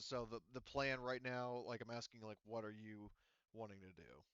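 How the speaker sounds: ordinary talk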